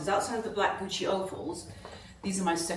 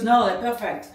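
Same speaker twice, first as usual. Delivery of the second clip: light imitation of a French accent, a bit louder; 4 semitones higher, 8 dB louder than usual. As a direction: retell the shop assistant's line with a mock French accent. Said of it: "in a French accent".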